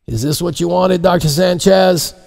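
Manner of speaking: polite pilot asking over the radio; pilot over headset. Acted. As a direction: pilot checking with the doctor. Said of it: "The pilot's voice crackled over the headset".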